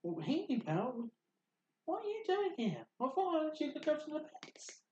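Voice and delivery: very high, squeaky cartoon voice; high in-character questioning; pony character voice. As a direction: pitch it way up like a startled little pony. Game master voices a pony character.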